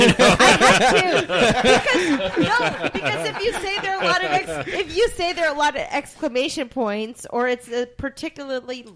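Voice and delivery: talking through loud laughter; giggling while explaining, pitch way up; laughing. Real, not acted. Laughing while defending her counting.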